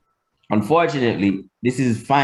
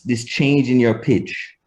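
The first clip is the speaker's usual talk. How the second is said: ordinary talk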